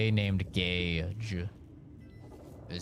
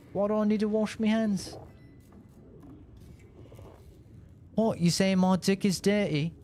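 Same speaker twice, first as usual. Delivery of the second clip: higher, laid-back mock Aussie bloke; Australian accent; 7 semitones higher than usual. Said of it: "Great impression".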